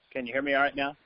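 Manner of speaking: barely audible over the phone; weak, distant phone audio; faint phone line. Real, not acted.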